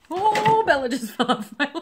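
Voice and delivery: high, loud yelp dissolving into laughter; surprised laugh. Real, not acted. Dog tumbles off her lap, loud laughing surprise.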